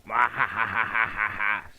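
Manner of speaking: loud maniacal evil laugh; mad scientist cackle. Pretend. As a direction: cackle like a villain who just won. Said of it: "Sorry about that, guys".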